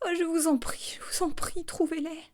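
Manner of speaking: high, breathy whispered begging; anguished pleading. A narrator's reading. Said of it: "Elle poussa une sorte de cri de souffrance".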